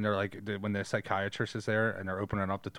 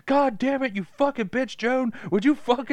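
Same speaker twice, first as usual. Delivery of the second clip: much higher, louder hammed-up screaming fit; over-the-top high-pitched rage at Joan; mock furious shriek; 14 semitones higher, 5 dB louder than usual. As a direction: shriek at the neighbor like you've snapped. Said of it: "where she's like".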